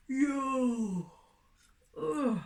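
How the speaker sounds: a wide, drawn-out stretching yawn; big yawn. Acted. Dog wakes with big yawn.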